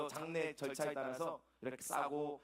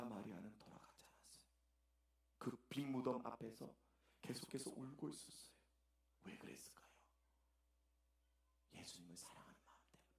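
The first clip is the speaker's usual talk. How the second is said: soft whisper, lower; 4 semitones lower, 11 dB quieter than usual. Real, not acted. Preacher whispering about Mary weeping at the empty tomb. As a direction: hush the room. Hushed telling of Mary's grief.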